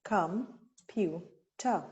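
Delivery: Com-pu-ter said very slowly, one beat each; tapped-out syllables. Shown as a demonstration. Teacher tapping syllables on her arm, very slow. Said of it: "I counted the three syllables".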